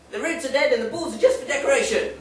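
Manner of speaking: laughing punchline. Acted.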